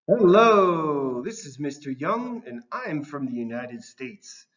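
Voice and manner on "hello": loud greeting. Acted. New host greets class.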